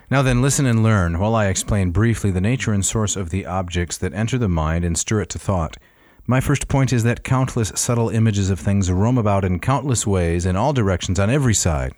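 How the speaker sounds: projected, measured reading voice; formal reading. Acted. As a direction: read it like a classic text aloud. Reads Smith translation aloud.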